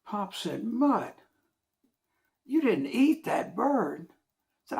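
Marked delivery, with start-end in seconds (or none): 0.6-4.1 appalled. Retold or imitated. Pop appalled at Mutt.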